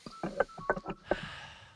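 long breathy sigh; deep sigh. Retold or imitated. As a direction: heave a slow dramatic sigh. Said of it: "I did this deep breath".

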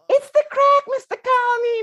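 proud squeaky falsetto; very high, piping child impression; high-pitched kid voice. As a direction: imitate a little boy announcing his answer. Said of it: "and says".

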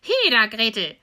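put-on witch hollering for the girl; loud call. Impatient witch summoning Gretel, loud call. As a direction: shout it out sharply to summon her. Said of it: "rief sie dem Mädchen zu".

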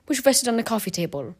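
ordinary delivery, nothing marked

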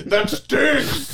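high squeal through laughter; laughing high squeal. Retold or imitated. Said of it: "I'd rather watch ... be like".

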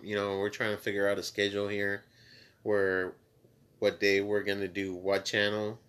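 ordinary talk

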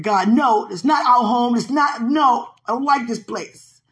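loud, high mock protest to God; retold tantrum. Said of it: "Went from having tantrums".

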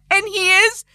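high, slow telling still tinged with a wail; lingering mock sob. Mother still echoing son's wail.

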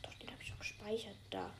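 quick hushed whisper. Real, not acted. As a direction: whisper it fast under your breath. Whispering during call setup.

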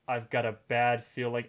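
normal talking voice

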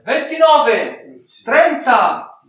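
slow sing-song auctioneer count; auctioneer bid calling. Acted. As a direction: call out each bid like an auctioneer.

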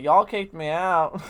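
slow, loud and bitter; angry Southern drawl. Retold or imitated. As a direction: spit it out slowly, seething. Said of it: "She's like".

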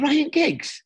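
high-pitched mock disbelief; incredulous retort. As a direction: throw the name back in disbelief. Retells his indignant reply on the pitch.

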